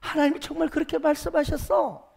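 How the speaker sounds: high, sustained questioning voice; sceptical question. Acted. Serpent sowing doubt about God's word, high-pitched question. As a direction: hold it high as a doubting challenge.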